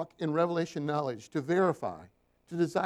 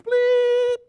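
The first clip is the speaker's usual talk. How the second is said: very high, much louder squeaky bleep; mock censor bleep; 11 dB louder than usual. Shown as a demonstration. Preacher censoring client's curse for church, shrill bleep. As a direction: cover the swear word with a shrill beep. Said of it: "I'm gonna bleep".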